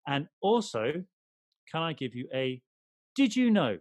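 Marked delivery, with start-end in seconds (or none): 3.1-3.8 high, loud mock-announcer call; jingle-announcer voice. Co-host introduces his fact segment in an announcer voice. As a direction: sing out the segment title loudly. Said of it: "say it in that type of voice".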